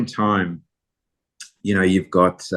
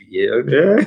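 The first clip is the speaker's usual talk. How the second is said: high, loud voice cracking with nerves; nervous teen voice cracking up; 7 semitones higher, 5 dB louder than usual. Retold or imitated. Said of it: "my voice is cracking like the, you know, the Krusty Burger kid".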